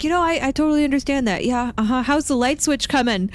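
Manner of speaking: fast, high, over-agreeable; flustered placating. Retold or imitated. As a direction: agree hurriedly, then steer off the subject. Acted hurried change of subject.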